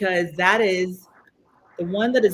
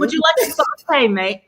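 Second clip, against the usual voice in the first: higher, louder pretend British accent; mock British accent; 7 semitones higher, 5 dB louder than usual. Friend joining the accent teasing, playful mock British accent.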